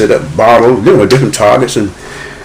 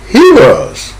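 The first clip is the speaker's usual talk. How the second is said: a hint of his younger self, pitched higher; boy answering; 8 semitones higher than usual. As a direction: answer mother like an eager boy.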